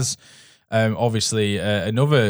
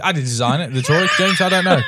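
laughing protest; 8 semitones higher, 4 dB louder than usual. Real, not acted. Laughing mock-defensive outburst.

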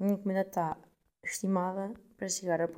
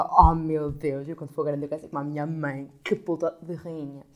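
loud, slow, lowered awe; starstruck admiration; 3 semitones lower, 7 dB louder than usual. Retold or imitated. Retelling her awe at her mother, gushing. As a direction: gush over your mother.

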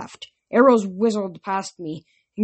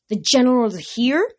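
raised, rising question in disbelief; stunned; 5 semitones higher than usual. A narrator's reading. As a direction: ask it as if stunned by the news. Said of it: "Zoe said, stunned".

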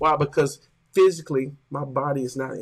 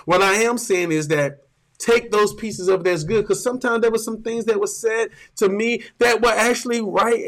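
full-force louder preaching; 3 semitones higher, 5 dB louder than usual. Acted. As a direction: drive it home to the congregation.

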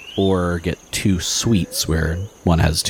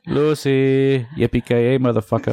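higher mashup of Ricky Ricardo and Bruce Willis; Ricky Ricardo meets Bruce Willis; 7 semitones higher than usual. Pretend. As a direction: ham up a Cuban bandleader crossed with an action hero. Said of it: "do Ricky Ricardo meets Bruce Willis".

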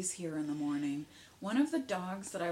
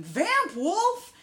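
high, loud yell with drawn-out vowels; yelled call; 13 semitones higher, 10 dB louder than usual. Retold or imitated. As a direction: yell it out like a battle cry. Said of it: "I yelled at him".

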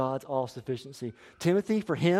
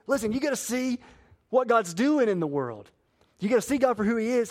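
rising fervor; 7 semitones higher, 4 dB louder than usual. Real, not acted. Preacher building to emphatic point.

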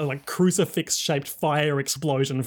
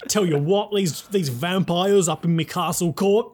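a hint of a blustering Australian; jokey Australian accent; mock Australian. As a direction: grumble like an outback Australian. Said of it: "Australian faux curse word".

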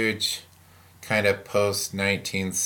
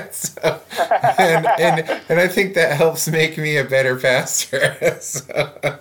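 laughing; 8 semitones higher, 7 dB louder than usual. Real, not acted. Pastor amused at himself among colleagues, laughing. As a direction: talk through a high laugh, louder. Laughing after self-deprecating joke.

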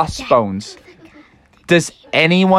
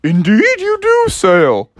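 playful otter voice; 14 semitones higher than usual. Acted. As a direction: tease him warmly as a cheeky otter. Otters popping up saying.

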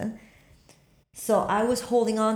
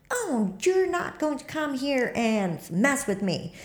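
defiant; 6 semitones higher, slower than usual. Retold or imitated. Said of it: "I'm so mad".

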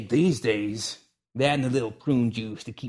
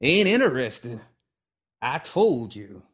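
curt refusal; 3 semitones higher, 6 dB louder, slower than usual. Acted. Old man repeats refusal.

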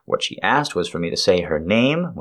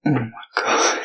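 breathless climax cry; climax moan; 5 semitones higher than usual. Acted. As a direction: gasp it out at the peak. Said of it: "here it is, an orgasm".

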